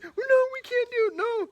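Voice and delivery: very high, loud wail of panic; freaking out. Retold or imitated. The people of Israel freak out, wailing in panic. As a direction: wail as if all is lost. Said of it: "freaking out".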